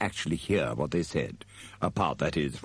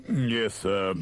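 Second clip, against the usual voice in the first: frostily formal, slightly raised; a cool butler's reply; frosty servant; 4 semitones higher than usual. A narrator's reading. Igor answering his master, frosty. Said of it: "Igor answered frostily".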